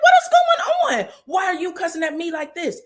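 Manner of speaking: high, loud startled yell; shocked shout. Retold or imitated. Nell yelling back at Wanda, high shocked shout. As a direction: cry out in disbelief.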